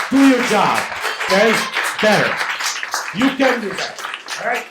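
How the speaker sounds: loud, heated scolding; angry. Real, not acted. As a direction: shout down the council with frustration. Angry resident scolding the council.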